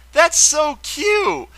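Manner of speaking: high, slow, drawn-out squeal of delight; gushing. Real, not acted. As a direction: melt over something adorable. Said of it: "I had a little happy moment".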